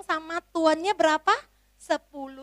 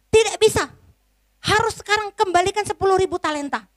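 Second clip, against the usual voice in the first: much higher and louder, fast furious refusal; angry master; 8 semitones higher, 5 dB louder, faster than usual. Acted. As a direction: snap at the servant like an angry lord. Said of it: "tuannya marah".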